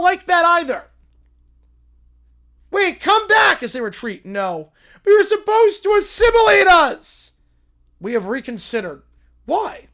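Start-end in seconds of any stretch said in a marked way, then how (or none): 2.7-7.3 loud, higher call after a leaving ship; shouting after them. Acted. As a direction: yell to bring them back. Calling after retreating ship.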